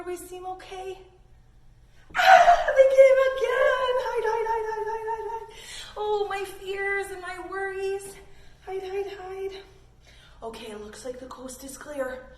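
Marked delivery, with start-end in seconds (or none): 2.2-5.5 high, loud frantic cry of hide; panicked hiding. Acted. Storyteller voicing a child panicking as worries return, loud frantic cry. 6.0-9.6 fretful worry. Acted. Character fretting about fears.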